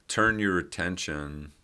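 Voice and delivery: normal talking voice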